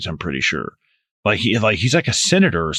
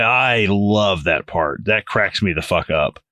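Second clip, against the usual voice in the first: ordinary talk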